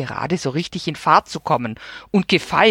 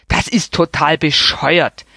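lightly voiced grumpy old man; sudden outburst. A narrator's reading. Old neighbour complaining about his Christmas birthday, mildly grumpy. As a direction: blurt out the complaint. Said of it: "fing Herr Thule plötzlich an".

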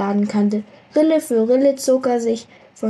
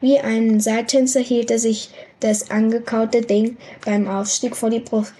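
ordinary delivery, nothing marked

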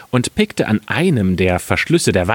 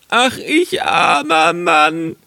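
high, drawn-out mock wail; wailing lament; 9 semitones higher, slower than usual. Carter finding his wine lost, wailing in high voice. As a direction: bemoan your bad luck, stretched out high. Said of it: "rief er".

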